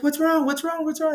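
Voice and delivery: high, urgent repeated questioning; alarmed nurse. Retold or imitated. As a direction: imitate a nurse in a mild panic. Nurse reacting to racing monitor, acted.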